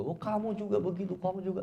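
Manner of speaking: high-pitched mock comeback; husband retorting. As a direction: throw the blame back, pitched well up. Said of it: "Suaminya balas lagi juga".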